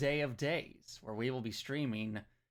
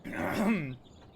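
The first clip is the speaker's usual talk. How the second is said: throat clearing; 5 semitones higher than usual. Real, not acted. Tea went down wrong pipe.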